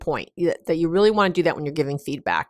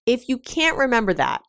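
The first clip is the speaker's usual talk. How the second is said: ordinary talk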